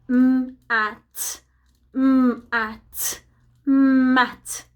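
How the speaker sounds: "M-at" split into m and at, then "mat" blended; sounding out. Shown as a demonstration. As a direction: segment "m-at" twice, then say "mat" whole. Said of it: "read it together".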